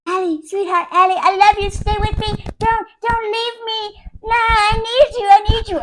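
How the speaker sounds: high, whiny begging; mock pleading. Needy husband begging wife to stay, high mock whimpering. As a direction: beg like a clingy husband, hammed-up.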